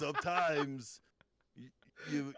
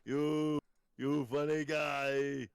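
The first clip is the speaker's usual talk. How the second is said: a hint of Austrian accent, amused and teasing; lightly voiced Austrian strongman chuckling; Austrian Arnold accent; slower than usual. Arnold teasing the host with an Austrian accent. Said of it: "Your Austrian accent".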